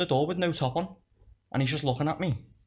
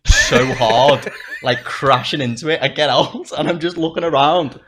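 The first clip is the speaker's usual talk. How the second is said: loud, incredulous retelling with laughter breaking in; amused, exasperated outburst; laughing storytelling; 8 dB louder than usual. Real, not acted. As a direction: tell it loudly through bursts of laughter. Laughing through retelling the video.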